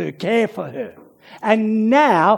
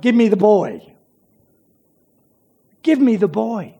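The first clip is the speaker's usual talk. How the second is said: abrupt. Retold or imitated. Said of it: "this abrupt".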